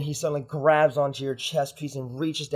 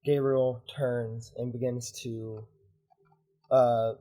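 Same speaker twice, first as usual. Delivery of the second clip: ordinary delivery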